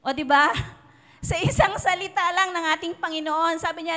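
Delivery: normal talking voice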